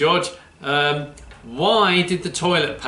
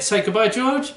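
ordinary talk, nothing marked

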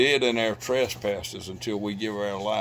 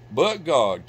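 "But God" thrown out high and loud, both words hit hard; ringing proclamation; 5 semitones higher, 8 dB louder than usual. Acted. Preacher proclaiming "But God" to his congregation, loud and high. Preacher repeats key phrase.

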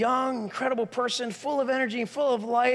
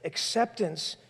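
normal talking voice

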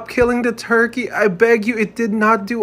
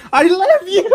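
high, loud wail breaking into laughter; wailed declaration; 9 semitones higher, 4 dB louder than usual. Real, not acted. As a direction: wail it out and crack up.

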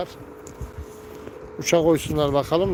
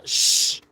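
1 shushing hiss. Real, not acted. Hushing a bird.

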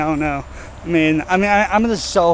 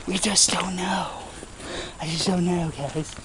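hushed unvoiced whisper; 10 dB quieter than usual. Real, not acted. Vlogger whispering to viewers that nobody knows.

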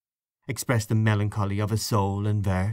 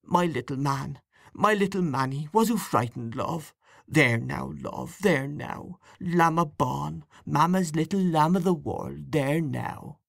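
murmuring; 5 semitones higher than usual. Acted. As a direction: soothe the crying baby tenderly.

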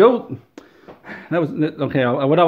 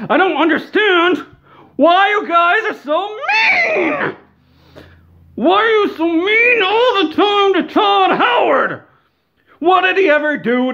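hammed-up crying; 13 semitones higher than usual. Ranting fan pleads for Todd.

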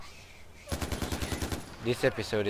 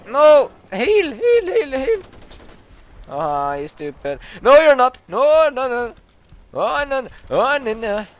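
high, loud desperate cries; desperate screaming; 10 semitones higher, 9 dB louder than usual. Real, not acted. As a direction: scream as it all goes wrong. Dying, spamming heal.

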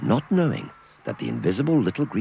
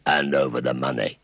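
growled; 4 semitones lower than usual. Acted. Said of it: "he growled".

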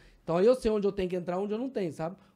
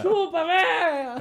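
high, loud, drawn-out jeer; laughing retold taunt; mock taunting yell; 9 semitones higher, 7 dB louder, much slower than usual. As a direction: stretch it out as a gloating shout.

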